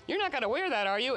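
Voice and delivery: high, needling nag voice; nagging hag. Retold or imitated. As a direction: needle him like a disapproving partner. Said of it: "the hag who's going".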